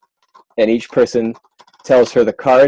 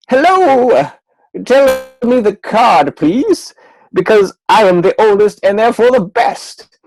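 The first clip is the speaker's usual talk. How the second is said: high haughty queen voice, louder; 11 semitones higher, 3 dB louder than usual. Acted. Magician voicing the queen asking the deck. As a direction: play the snobbish old queen.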